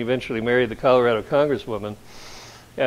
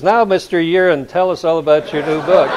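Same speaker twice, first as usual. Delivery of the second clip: interviewer voice; 5 semitones higher, 7 dB louder than usual. Retold or imitated. Retelling an interviewer's intro.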